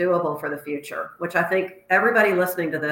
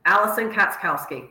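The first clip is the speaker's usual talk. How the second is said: normal talking voice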